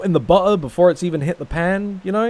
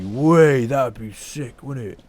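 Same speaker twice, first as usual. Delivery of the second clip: put-on ironic English accent; slower than usual. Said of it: "with an ironic English accent".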